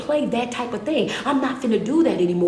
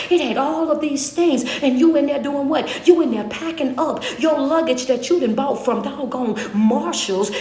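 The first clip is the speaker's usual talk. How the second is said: louder, faster, climbing to a preaching peak; preaching at full force; 4 semitones higher, 4 dB louder than usual. Acted. Preacher to congregation, building in full force. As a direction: drive the sermon home, voice rising.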